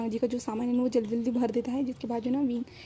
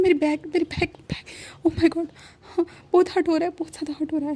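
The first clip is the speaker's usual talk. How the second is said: high, loud cry of back pain; pained yelling; 5 semitones higher, 4 dB louder than usual. Retold or imitated. Wang Ji waking up, crying out about his back, high pained yell. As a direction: wail it out as the pain hits. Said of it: "वांग जी चिल्लाता है".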